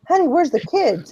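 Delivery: put-on high, loud panicked wife asking for the kids; alarmed wife. Wife suddenly afraid for the kids, high and loud. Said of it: "She goes".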